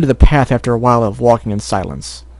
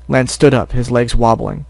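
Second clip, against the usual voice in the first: plain reading voice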